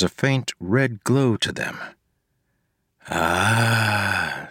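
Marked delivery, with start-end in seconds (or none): 3.1-4.5 rasping voice. A narrator's reading. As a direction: rasp it like a dying creature. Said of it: "she said in a rasping voice".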